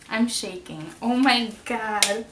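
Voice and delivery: ordinary delivery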